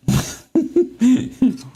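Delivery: high giggle at himself; high burst of laughter. Real, not acted. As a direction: crack up at the slip. Laughing at own mistake.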